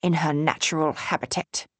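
mock nature documentary in Australian; bad Australian accent. Julie joking about Patsy, in a bad Australian accent. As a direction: finish in a badly done Australian accent. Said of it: "doing a bad Australian accent".

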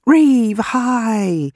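high, loud happy hello; excited greeting. Acted. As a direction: call a friend over gladly. Janice calling out a welcome.

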